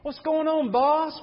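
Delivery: high, playful hey what's up big man; buddy greeting to a kid. Retold or imitated. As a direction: greet a little fella like a big shot. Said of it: "We say that to these guys".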